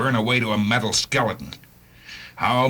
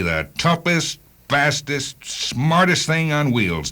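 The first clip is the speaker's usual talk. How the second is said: each word pitched higher, slowing on the last; proud build-up; 6 semitones higher, slower than usual. Acted. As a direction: stack the praise, climbing with pride. Boasting of the car he built.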